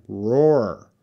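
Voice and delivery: "roar" stretched, with a falling tune; pronunciation demo. Shown as a demonstration. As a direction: ROAR drawn out, both R's clear. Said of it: "how to pronounce roar".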